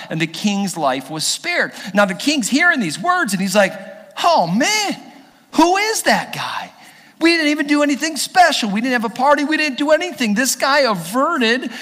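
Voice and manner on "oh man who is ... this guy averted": high-pitched comic astonishment; amazed king. Acted. King hearing who saved his life, amazed. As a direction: react high and incredulous, as the startled king.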